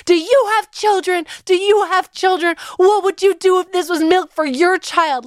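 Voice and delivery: replaying her yell at the guards; mother yelling at security guards, retold. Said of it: "I'm literally yelling".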